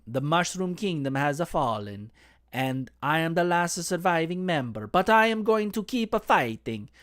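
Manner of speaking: hammed-up cartoon Italian accent, lilting and rising; Luigi. Cosplayer voicing the last surviving Mushroom Kingdom member, Italian accent. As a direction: put on an insulting Italian accent, earnest survivor. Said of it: "me doing my Luigi voice".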